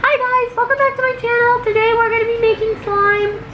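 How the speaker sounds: high, loud squeaky puppy voice. Pretend. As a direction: speak for the dog in a tiny high squeak. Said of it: "pretend it's him doing the video".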